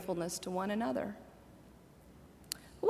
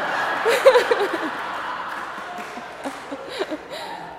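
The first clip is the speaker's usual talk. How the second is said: breathy laugh rising high; high airy laugh; 9 semitones higher, 6 dB louder than usual. Real, not acted. Preacher laughing at her own threshing floor aside, airy laugh. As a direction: giggle at your own joke.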